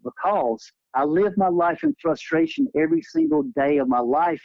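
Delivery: normal talking voice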